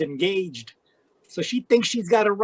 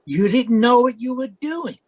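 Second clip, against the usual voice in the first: sharp, louder and higher accusing line; angry retort; 5 semitones higher, 5 dB louder than usual. Acted. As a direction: throw her words back at her in anger. Villain angrily contradicts her.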